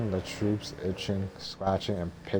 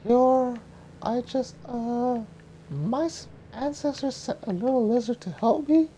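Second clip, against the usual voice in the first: put-on high, halting disbelieving girl; high young woman voice. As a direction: stammer it high and incredulous.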